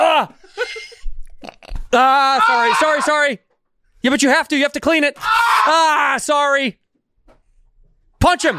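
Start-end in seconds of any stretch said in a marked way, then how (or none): 0.0-6.8 pained cries while cleaning a cut; wound being cleaned. Real, not acted. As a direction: yelp from the stinging alcohol.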